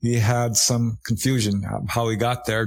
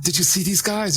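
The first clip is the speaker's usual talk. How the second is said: higher, urgent mock asking; pressing his friend, mildly imitated; eager question; 8 semitones higher than usual. Man urging his friend about the strangers, higher and eager. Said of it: "he was gonna ask him".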